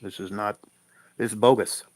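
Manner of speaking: ordinary talk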